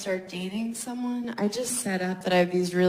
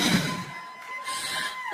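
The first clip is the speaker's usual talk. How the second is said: shrill shriek of laughter; high squealing laugh; 4 dB quieter than usual. Real, not acted. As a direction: burst out in a squeal. Loud laugh alone on line.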